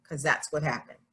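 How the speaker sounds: ordinary delivery, nothing marked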